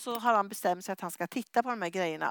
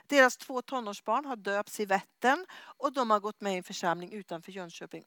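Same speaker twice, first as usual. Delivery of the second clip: ordinary talk, nothing marked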